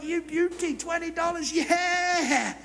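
put-on delighted young boy; thrilled kid. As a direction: cheer like a boy handed cash. Boy delighted with twenty dollars.

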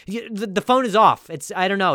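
flustered, tumbling over the excuse; mock panic. Fake boyfriend scrambling to explain himself, panicked. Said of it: "panicked right now".

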